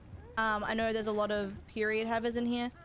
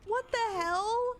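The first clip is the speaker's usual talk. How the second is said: much higher, slow what-the-hell; incredulous; 11 semitones higher, slower than usual. Real, not acted. Streamer baffled by the match, high slow disbelief. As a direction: drag it out in disbelief. Reacting to game.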